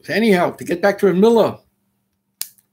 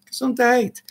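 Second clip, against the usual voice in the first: normal talking voice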